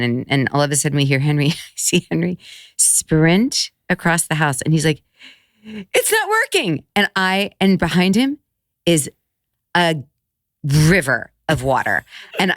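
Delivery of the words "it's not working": high, drawn-out mock wail; retold panicked child. Son running to mom about the toilet, mock panicked. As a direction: imitate an alarmed kid, stretched high.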